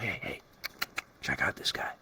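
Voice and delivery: hushed whisper to a buddy. Retold or imitated. Whispering to a friend about a newcomer, hushed. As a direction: whisper it so the newbie can't hear.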